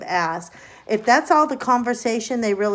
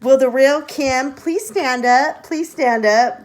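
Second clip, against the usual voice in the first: higher, louder sing-song call; playful call; 4 semitones higher, 5 dB louder than usual. Acted. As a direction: summon the real Kim.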